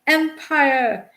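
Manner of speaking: high, loud rallying cries; awful cries. A narrator's reading. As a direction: cry it out like a flag-waving Brit. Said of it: "awful cries of".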